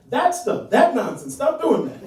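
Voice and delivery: high, loud dad laying down the law; scolding dad. Acted. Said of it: "any impression I do of you".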